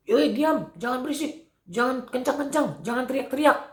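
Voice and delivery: high, mock-annoyed hushing; scolding crowd. Crowd telling the beggar to stop yelling, scolding. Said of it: "kalau sekarang mungkin".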